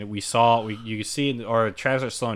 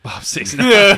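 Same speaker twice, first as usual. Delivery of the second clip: much higher and louder, called out like a title; booming mock announcement; 9 semitones higher, 12 dB louder, much slower than usual. Friend calling out a joke name, loud and high.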